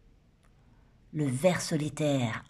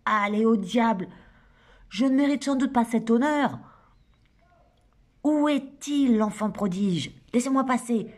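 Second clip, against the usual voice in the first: higher, louder scolding woman's voice; lightly voiced bossy woman bursting into a party; indignant Shura; 5 semitones higher, 4 dB louder than usual. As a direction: burst in scolding everyone, half joking. Narrator voicing Shura Schlesinger.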